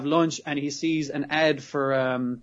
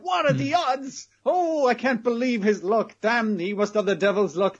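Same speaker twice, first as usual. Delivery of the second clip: mock astonishment; 7 semitones higher than usual. Host marvelling at Tanigawa's luck, mock astonishment. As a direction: ham up disbelief at someone's luck.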